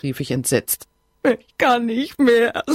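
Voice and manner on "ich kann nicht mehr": slow, higher, louder sobbing plea. Acted. Heartbroken friend on the phone to Bridget, sobbing. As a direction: sob it out, barely holding together. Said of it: "schluchzte sie".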